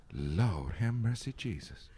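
mother's reaction. Retold or imitated. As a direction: draw it out slowly, dropping away softly. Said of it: "she went".

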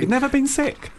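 high incredulous question; can't believe it; surprised. Real, not acted. Surprised at claim.

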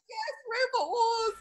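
high, thrilled little yelp of joy; excited cheer. Retold or imitated. Dreaming of Robot Wars as a child, high excited cheer. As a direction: cheer it like a kid meeting her heroes. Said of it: "I'd have been so excited".

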